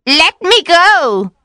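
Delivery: high, loud, slow pleading cry; witch's frightened cry. A narrator's reading. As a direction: shriek to break free of his grip. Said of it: "she cried".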